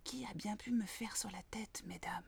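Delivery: soft whisper; a mole whispering politely to two flies. A narrator's reading. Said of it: "Et elle chuchota".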